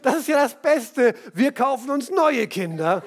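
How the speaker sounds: höher und lauter, durchs Lachen gesprochen; lachend scherzend. Retold or imitated. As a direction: mit einem Lachen witzeln. Mann scherzt über Kinder. (lachend gescherzt)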